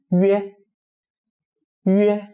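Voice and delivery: "yue" as a rounded ü gliding into e; pieced-together sound. Shown as a demonstration. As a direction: hold "yue" high and level. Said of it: "read it as E and O O to make a U sound".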